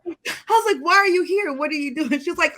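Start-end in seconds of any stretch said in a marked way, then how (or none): 0.8-2.2 bewildered questions, starting high; retold confusion, pitched up at first; confused. Asking a friend who turned up unexpectedly, confused. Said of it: "so confused".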